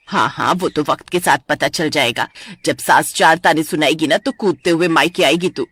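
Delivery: low, mocking older mother; teasing mother. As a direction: tease your daughter from deep down. Mother voiced in story.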